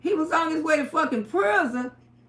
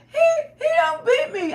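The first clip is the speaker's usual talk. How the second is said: pleading; 11 semitones higher, 3 dB louder than usual. Retold or imitated. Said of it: "I say".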